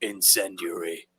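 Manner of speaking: very low, soft growled command; low, understated lawman voice; Dredd's voice. Acted. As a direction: drop deep and quiet, deadpan. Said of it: "That voice".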